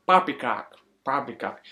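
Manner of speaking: a hint of a fake British accent, repeated; lightly imitating a bad British accent; understated mock British poppycock. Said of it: "British accent that he does".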